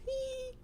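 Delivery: tiny high-pitched giggle. A narrator's reading. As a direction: squeak out one small "hee". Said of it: "Tukey couldn't help but giggle".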